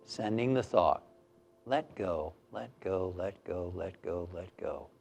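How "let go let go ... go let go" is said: low, soft repeats fading away; soothing release. Shown as a demonstration. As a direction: lull the listener, each repeat quieter. Sends a release thought to body.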